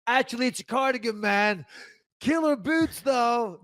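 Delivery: high goofy movie-quote voice; Dumb and Dumber quote. Retold or imitated. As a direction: ham up the dim-witted movie line. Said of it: "Dumb and dumb".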